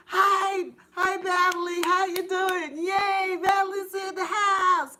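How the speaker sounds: high, loud cheery welcome; excited greeting. Real, not acted. Host welcoming Natalie into the chat, high and loud. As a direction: hail her like a friend arriving.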